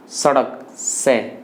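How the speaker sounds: slow, separated सड़क से with a dental s; dental S demo. Shown as a demonstration. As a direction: say सड़क, then isolate its s sound as से, tongue at the teeth. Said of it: "इसका उच्चारण दंत से हो रहा है". (सड़क से, धीरे, दंत्य स के साथ)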